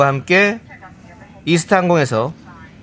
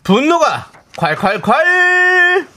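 high drawn-out title call; hammed-up announcer shout; 11 semitones higher, much slower than usual. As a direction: boom out the segment name slowly.

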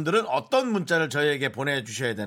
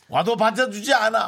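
parody news anchor; 7 semitones higher, 6 dB louder than usual. Shown as a demonstration. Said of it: "국방부 왈".

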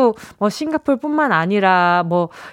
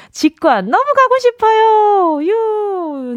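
pleading; 9 semitones higher, 3 dB louder, much slower than usual. Acted. Reading a listener's text.

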